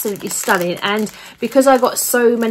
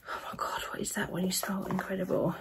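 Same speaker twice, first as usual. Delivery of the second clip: whispered in awe, much softer; awed whisper; 3 semitones lower, 16 dB quieter, faster than usual. Real, not acted. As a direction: breathe it out in wonder at the smell. Sniffing bath bombs, awed.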